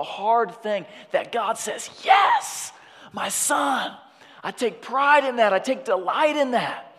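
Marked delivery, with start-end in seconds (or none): 2.0-6.9 high, loud shout of fatherly pride; exultant approval. Acted. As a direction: cry out delight as God to a son. Voices God's proud approval.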